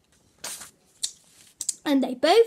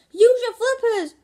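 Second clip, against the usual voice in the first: high, loud shout; 9 semitones higher, 7 dB louder than usual. Acted. Wossie shouts to Gasper as he jumps in. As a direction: yell it across the splashing water. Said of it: "shouted Wossie".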